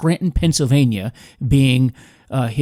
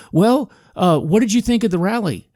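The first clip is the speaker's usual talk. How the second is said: a bit higher, a hint of a kid; lightly voiced boy; 6 semitones higher than usual. Man retelling the boy's question, a hint of kid voice. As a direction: nudge the pitch up for the kid.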